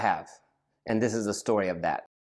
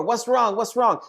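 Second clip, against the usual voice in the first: high, raised shouted question; host yelling through the door at the screaming guest; 11 semitones higher than usual. Retold or imitated. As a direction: call anxiously through the door. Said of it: "start yelling at him through the door".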